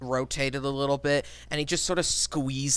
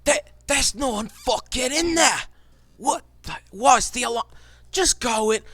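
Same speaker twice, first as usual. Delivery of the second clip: high, exasperated dwarf shouting; 10 semitones higher, 3 dB louder than usual. Acted. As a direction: yell it like a baffled, cursing dwarf. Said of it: "The dwarves are initially fooled".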